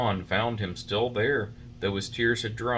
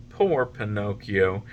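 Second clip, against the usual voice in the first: usual speaking voice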